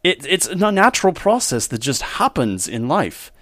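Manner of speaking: hammed-up Australian lover explaining love; Australian accent. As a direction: play an Australian gentleman in love, unhurried. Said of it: "Australian it is".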